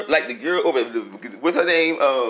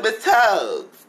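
"Miss Tugs" called out, higher and louder; calling her name; 6 semitones higher, 4 dB louder than usual. Retold or imitated. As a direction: call "Miss Tugs" across the room, drawn out. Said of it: "I said".

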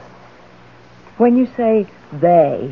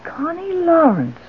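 much higher, slow, incredulous repeat; surprised; 11 semitones higher, slower than usual. Acted. Wife hearing Charles suggest her old roommate, surprised. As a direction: echo the name in disbelief.

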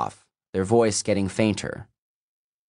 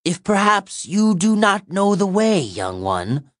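high, loud mocking hag; ancient monster voice; 11 semitones higher, 4 dB louder than usual. A narrator's reading. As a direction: sneer at the young one. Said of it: "gravelly and ancient like the first".

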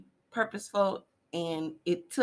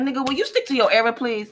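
loud fast mocking callout; mock shout; 5 semitones higher, 9 dB louder, faster than usual. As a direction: yell it fast, teasing. Retold [name] callout.

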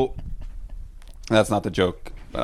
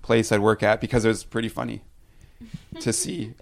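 ordinary talk, nothing marked

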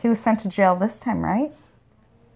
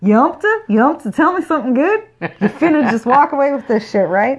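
put-on Southern accent; 4 semitones higher than usual. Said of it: "I done come from the South".

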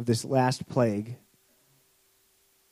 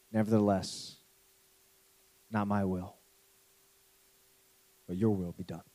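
much softer and lower, reverent; hushed prayer; 6 semitones lower, 9 dB quieter than usual. Acted. Jesus praying to the Father, soft and low. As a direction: surrender in a near whisper.

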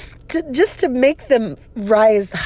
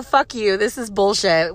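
usual speaking voice

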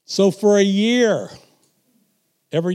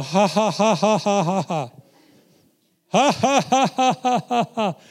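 forced laugh; 2 semitones higher than usual. Retold or imitated. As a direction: laugh on purpose at the devil. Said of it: "I didn't feel like laughing".

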